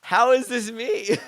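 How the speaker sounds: loud, high, drawn-out disbelief with laughter; baffled, laughing cry of protest; incredulous outcry. Retold or imitated. Repeats baffled reaction, laughing.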